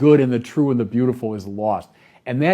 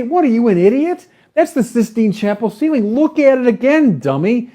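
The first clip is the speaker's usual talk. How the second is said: higher, louder mock scolding of a fool; exasperated rebuke; 9 semitones higher, 4 dB louder than usual. A friend scolding someone who shrugs at the Sistine Chapel, exasperated and louder. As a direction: bark it at someone who can't see the obvious. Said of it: "You'd say".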